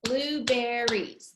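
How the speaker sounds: Blueberries slow in three beats; "Blueberries" drawn out syllable by syllable. Shown as a demonstration. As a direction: clap out BLUE-BER-RIES evenly. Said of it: "There were three syllables".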